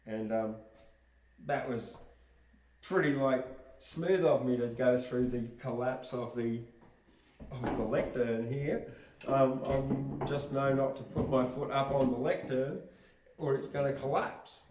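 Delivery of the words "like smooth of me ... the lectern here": ordinary talk, nothing marked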